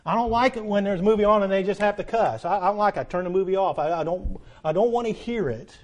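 ordinary talk, nothing marked

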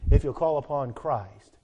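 normal talking voice

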